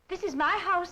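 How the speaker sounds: high-pitched, indignant assertion; quarrelling, putting someone in their place. Acted. Aunt asserts herself in quarrel.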